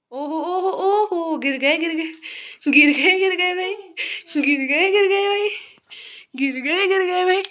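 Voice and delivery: playful fussing. Real, not acted. Mother as her child falls down, playful loud fussing.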